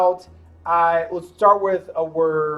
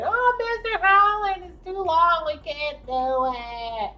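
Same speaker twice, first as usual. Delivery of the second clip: mock whining kid, much higher; whiny student; 12 semitones higher, slower than usual. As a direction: whine like a student who gives up. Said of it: "I know what you're thinking".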